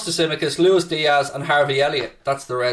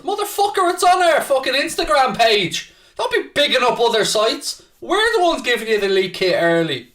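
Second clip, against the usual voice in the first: higher, indignant rant; mock outrage; 7 semitones higher than usual. Real, not acted. Streamer berating a viewer for promoting another site, mock outraged. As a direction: blow up in playful fury at the chat.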